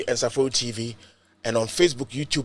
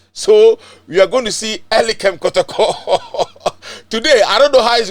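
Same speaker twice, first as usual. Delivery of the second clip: laughing through the words, louder; 6 semitones higher, 6 dB louder than usual. Real, not acted. Host introducing his angry guest, laughing. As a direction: chuckle as you announce him.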